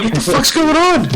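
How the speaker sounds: high, panicky question; frantic. Retold or imitated. Fan desperate for the score, high frantic question. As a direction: freak out high and fast.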